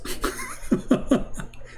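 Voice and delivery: high, voiceless wheezy laugh; helpless giggle in a high pitch. Real, not acted. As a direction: crack up at your own story. Laughs at own story.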